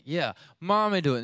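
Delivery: put-on high, loud childish yell; screaming little girl. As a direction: shout it like a fed-up toddler. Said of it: "two girls screaming".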